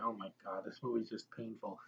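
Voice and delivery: hushed, deflated groan of boredom; a tired, quiet mock complaint; muttered aside. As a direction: mutter it under your breath. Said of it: "I'm like".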